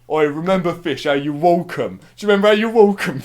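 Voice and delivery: loud, high-pitched mock Cockney; Cockney accent. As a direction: imitate a mate hollering in broad Cockney. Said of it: "His fucking Cockney bloody accent".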